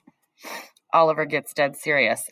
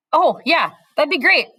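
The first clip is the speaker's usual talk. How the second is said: higher, louder happy reply; delighted yes; 12 semitones higher, 6 dB louder than usual. A narrator's reading. Allie accepting a lunch invite, delighted. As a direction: burst out glad.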